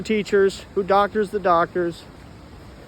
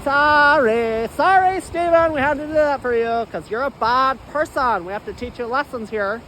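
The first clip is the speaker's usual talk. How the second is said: higher taunting voice with a laugh; mocking tormentor; 7 semitones higher than usual. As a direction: gloat like a villain scolding a victim. Mind jackers taunt the speaker.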